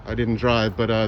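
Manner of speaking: usual speaking voice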